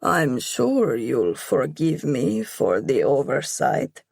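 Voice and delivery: mocking. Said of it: "with a mocking smile".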